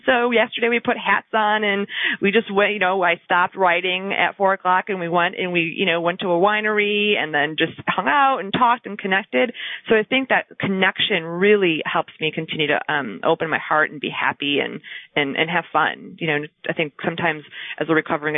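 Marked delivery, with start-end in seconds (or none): none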